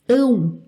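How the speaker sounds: "ão" said as a nasal diphthong; nasal ÃO ending. Shown as a demonstration. Teacher showing learners how the final sounds, nasal ÃO. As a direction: model the nasal "ão" sound on its own. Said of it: "O final, né, parece".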